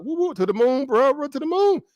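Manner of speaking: high-pitched, over-the-top cheering; mock investor hype. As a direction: whoop it up like a hyped stock buyer. Mock investor hyping the stock.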